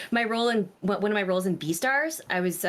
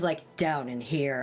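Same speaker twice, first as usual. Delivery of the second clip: low rough morning voice; 6 semitones lower than usual. Shown as a demonstration. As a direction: drop into a low, worn-out voice. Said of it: "my voice was".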